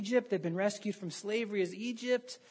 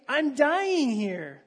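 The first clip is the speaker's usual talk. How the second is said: exasperated plea; 5 semitones higher, 7 dB louder, much slower than usual. Retold or imitated. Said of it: "you would think".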